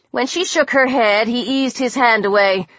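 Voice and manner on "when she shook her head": ordinary delivery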